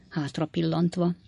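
plain reading voice

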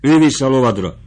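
urgent shout. Acted. Sutler woman shouts at Fabrizio to remount, loud. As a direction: shout the order at him. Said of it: "kiáltott fel a markotányos nő".

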